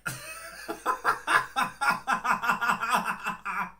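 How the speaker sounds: loud high helpless laughter. Real, not acted. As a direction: crack up out loud. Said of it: "I'm laughing because".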